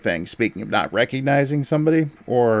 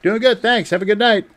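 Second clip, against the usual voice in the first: cheery reply; 10 semitones higher than usual. Retold or imitated. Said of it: "It's like".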